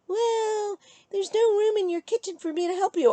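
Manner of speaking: high, whiny-style imitation of an ex; mocked ex's excuse. Ex excusing himself from helping in kitchen, mockingly imitated.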